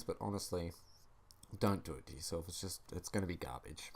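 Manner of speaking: usual speaking voice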